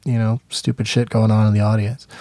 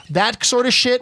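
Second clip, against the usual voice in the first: usual speaking voice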